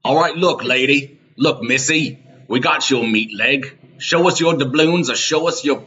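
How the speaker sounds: hammed-up vendor hassling the lady; pushy vendor. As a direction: lean in and demand payment. Improvised Renaissance fair scene.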